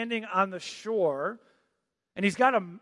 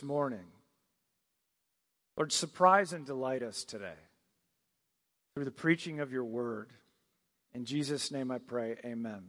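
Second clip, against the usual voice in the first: soft, low opening prayer; 4 semitones lower, 3 dB quieter than usual. Acted. Pastor praying softly before the sermon. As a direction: pray quietly, leading the church into the sermon.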